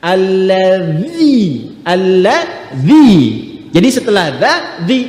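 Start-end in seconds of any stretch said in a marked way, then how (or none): 0.1-3.1 "Alladzi" drawn out slowly, dzal clearly voiced; slow drawn-out recitation. Shown as a demonstration. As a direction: stretch "alladzi" slowly and evenly. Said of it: "ayat ketiganya pakai di". (ucapkan "alladzi" perlahan dan panjang)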